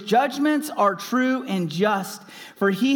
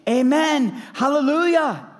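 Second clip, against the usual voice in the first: worshipful cry; 7 semitones higher, 3 dB louder than usual. A narrator's reading. Elders worship God.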